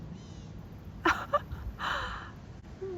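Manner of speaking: soft, high, breathy laughter; breathless nervous laugh. Real, not acted. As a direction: laugh under your breath in disbelief. Reacts to the giant alligator.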